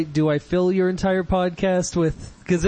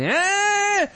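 high-pitched mock child's yell; imitated kid scream. Neighbor's child screams in pain, imitated. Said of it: "where it's just going".

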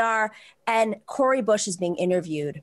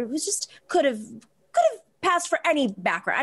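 usual speaking voice

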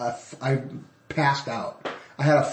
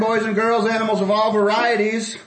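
booming ringmaster announcement; 8 semitones higher, 5 dB louder than usual. Acted. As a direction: roll up, roll up, big top style. Podcast host opening announcement.

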